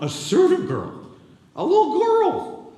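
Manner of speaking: high slow mock voice; mocking little girl. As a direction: make her sound tiny and silly. Mocking the servant girl's lowliness.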